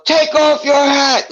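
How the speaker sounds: loud, high, drawn-out yell; yelled order. Retold or imitated. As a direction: imitate a court officer yelling an order. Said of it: "like, yelling".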